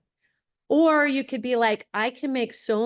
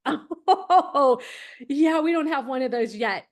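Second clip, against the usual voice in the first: laughing admission; 2 semitones higher than usual. Retold or imitated. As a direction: say it through a real laugh. Laughing at having no plan.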